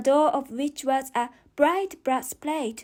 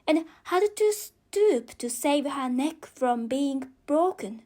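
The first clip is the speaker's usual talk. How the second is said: plain reading voice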